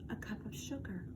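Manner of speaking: sly unvoiced whisper. A narrator's reading. Wolf whispering his request to the listener, sly whisper. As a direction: breathe it like a secret ask.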